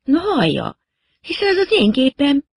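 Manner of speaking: voiced young woman, offended; indignant girl. A narrator's reading. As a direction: protest that it's her plane. Girl indignant about her plane.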